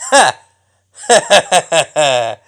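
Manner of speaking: point-and-laugh gloating laugh, punched out loud; mocking laugh. Gloating at a beaten opponent, loud mocking laugh. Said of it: "and point and laugh".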